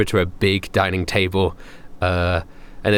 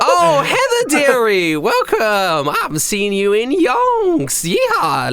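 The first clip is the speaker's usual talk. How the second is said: cheery centaur waitress; 13 semitones higher, slower than usual. Acted. Centaur waitress greets the party, high hammed-up drawl. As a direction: belt a big cowgirl welcome. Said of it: "She goes".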